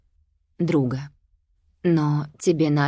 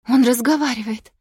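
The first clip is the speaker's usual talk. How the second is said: loud, high astonished cry; 7 semitones higher, 6 dB louder than usual. A narrator's reading. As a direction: gasp it out in shock. Mother astonished.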